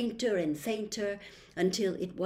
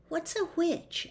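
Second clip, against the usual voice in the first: higher puzzled question; curious boy; 10 semitones higher, 3 dB quieter than usual. A narrator's reading. Milo asking the old lady, higher and curious. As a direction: ask it wide-eyed. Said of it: "asked Milo".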